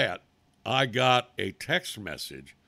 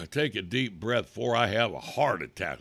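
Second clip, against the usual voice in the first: normal talking voice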